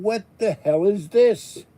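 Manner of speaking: high, drawn-out bewildered protest. Retold or imitated. Streamer recalling hockey fans taking over his chat, frustrated. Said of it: "when I raise my voice".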